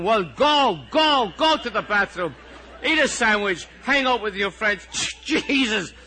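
high, loud tirade building to a shout; exasperated outburst. Acted. Teacher snapping at the whole class, exasperated outburst. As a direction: give up and yell permission for everything.

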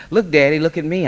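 put-on high, loud, thrilled child; excited. A narrator's reading. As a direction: show off eagerly to Daddy. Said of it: "I said with excitement".

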